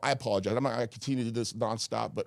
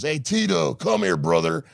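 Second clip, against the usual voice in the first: Hulk Hogan impression; 8 semitones higher, 7 dB louder, slower than usual. Quoting Hogan greeting him.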